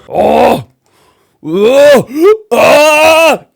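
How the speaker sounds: loud, rising gasps before a sneeze; building sneeze. Acted. Giant Most about to sneeze, gasping build-up. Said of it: "he went".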